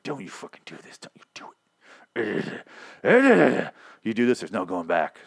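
hushed, menacing Rick telling him not to do it. Retold or imitated. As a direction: half-whisper a dead-serious threat. Rick threatens the Savior.

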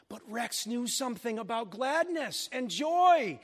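high, drawn-out preaching; impassioned preaching. Real, not acted. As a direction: proclaim it with slow, high emphasis.

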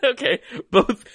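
high, loud laughing talk. Real, not acted. Laughing while explaining.

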